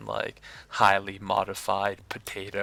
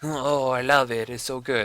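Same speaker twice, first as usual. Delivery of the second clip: mock French chef; 4 semitones higher, 6 dB louder than usual. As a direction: play a delirious French chef in a mock French accent, savoring the fries. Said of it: "He's like".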